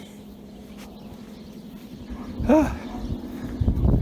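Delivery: long breathy sigh; heavy sigh before complaining about being out of breath; tired sigh. Real, not acted. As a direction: let out a weary sigh. Out of breath while feeding.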